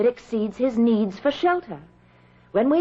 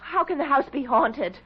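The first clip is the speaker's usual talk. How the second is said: ordinary delivery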